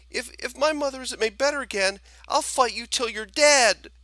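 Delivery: put-on a boy yelling a desperate threat; high furious threat.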